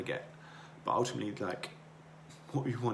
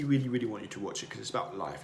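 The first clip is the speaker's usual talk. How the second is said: normal talking voice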